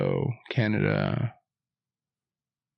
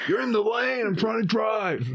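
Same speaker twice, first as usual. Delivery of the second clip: mock whiny driver; 11 semitones higher than usual. Mocking another driver's complaint.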